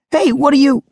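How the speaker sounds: high, loud indignant shout. Acted. Wasabi yells after the girl who grabbed his tool, indignant shout. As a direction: call after her as she runs off with your tool. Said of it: "Wasabi shouted".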